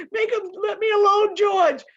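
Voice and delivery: high, loud, panicked pleading; terrified cry. Acted. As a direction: beg George to make it stop. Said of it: "he cried".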